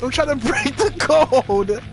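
high-pitched, strained protest; desperate straining. Real, not acted. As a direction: plead mid-struggle. Straining to break the code.

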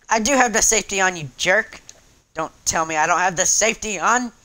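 loud, irritated comeback; indignant retort. Real, not acted. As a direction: argue back loudly, starting fast. Snaps back at game voice.

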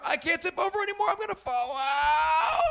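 high, fast mock panic. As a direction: pretend you're falling over. Teapot tipping over falling.

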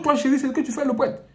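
high, loud demanding tone; accusing husband. Acted. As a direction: demand an answer sharply. Husband questions wife, acted scene.

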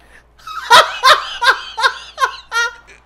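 loud, high burst of laughter; high shrieking laugh. Real, not acted. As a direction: crack up uncontrollably. Cracking up at the joke.

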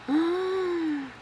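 long, rising gasp of wonder; real gasp of wonder; delighted gasp. Real, not acted. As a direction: let the breath catch in awe. Opens another gift item.

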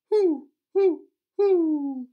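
very high, loud hooting; owl hoot. Shown as a demonstration. Teacher hooting like an owl, very high and loud. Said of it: "Can you hoo like an owl?".

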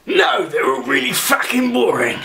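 high, exasperated trader's comeback; brash seller's retort. Acted. Seller answering a customer's question about books, brash and high. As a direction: fire back like a rude stallholder.

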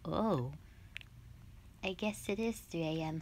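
ordinary talk, nothing marked

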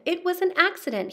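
quick high protest from the dragon. Acted. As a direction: blurt it out defensively. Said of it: "he roared".

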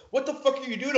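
retold panicked outcry; imitated alarmed bandmates. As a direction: confront a friend losing it.

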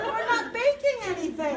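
half-laughing yell, high and loud; laughing as she shouts it at him; exasperated shout. Retold or imitated. Wife yelling at panicking husband, laughing shout.

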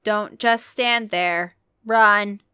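an irritated announcer, booming; impatient boom. A narrator's reading. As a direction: hurry them along from the PA. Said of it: "the voice boomed impatiently".

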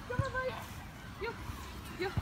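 encouraging the puppy to follow; coaxing the dog. Real, not acted. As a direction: sweet-talk the dog along.

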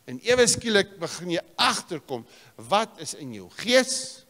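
high, loud, ringing preacher's cry; full-force pulpit preaching; fiery preaching. Acted. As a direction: preach it out to the whole hall. Preacher building to a climax.

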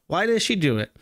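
ordinary talk, nothing marked

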